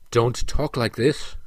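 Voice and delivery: ordinary delivery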